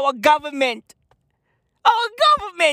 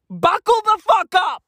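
shouted warning; 6 semitones higher, 6 dB louder than usual. Acted. Comic repeat of the warning to non-Zulus, now shouted. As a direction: yell it, punching "fuck" hard and loud.

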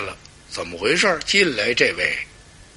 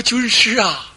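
pleading officials; 6 semitones higher than usual. Acted. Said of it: "心里说".

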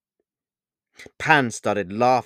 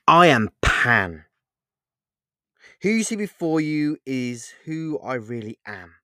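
grand proclamation; 2 semitones higher, 6 dB louder than usual. A narrator's reading. Pan reveals who he is to Paul, grandly proclaiming.

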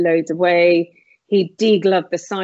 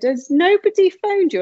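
surprised nurse; 12 semitones higher, 3 dB louder than usual. Retold or imitated. Nurse's surprised question quoted.

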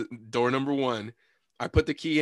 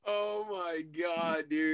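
slow and softer, still laughing; 4 semitones higher, 6 dB quieter, much slower than usual. Real, not acted. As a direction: catch your breath after laughing. Winding down after laughing.

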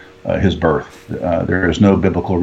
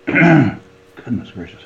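hoarse, strained voice going; throat catching, voice cracking hoarse; losing voice; 4 semitones higher than usual. Real, not acted. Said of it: "Sorry, I'm losing my voice".

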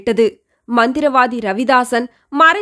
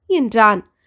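plain reading voice

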